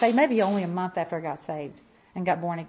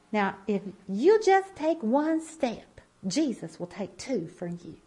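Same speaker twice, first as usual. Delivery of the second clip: slow, lightly drawled Southern lady's voice; a gentle Southern woman's encouragement, slowed down; Southern accent; slower than usual. Retold or imitated. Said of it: "I had a real Southern accent. She sounded like you".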